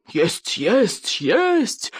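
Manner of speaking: repeated word climbing to a high yelp; exasperated storybook yell; yelled insistence. A narrator's reading. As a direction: lose patience, pitch soaring on the last. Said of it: "завопил Норберт Сумасброд".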